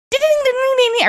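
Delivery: high-pitched ringing phone noise; imitated ringtone. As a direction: ring out like a phone. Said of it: "And then I hear".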